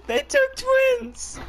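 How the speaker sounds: over-the-top pretend grief; mock wail. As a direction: stretch it out like fake mourning. Mock lament over teammate.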